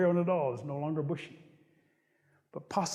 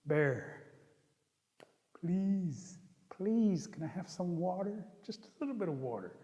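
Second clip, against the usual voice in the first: softer, coaxing plea; meek pleading; 5 dB quieter, slower than usual. Acted. Rabbit begs Bear for a little water, meek pleading. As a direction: beg small and quietly, trailing softer.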